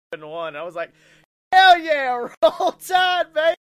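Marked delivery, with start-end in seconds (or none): 1.5-3.5 high, loud whooping shout; excited cheer. Retold or imitated. Host re-enacts his own reaction to winning, loud high cheer. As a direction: belt it like a rowdy fan.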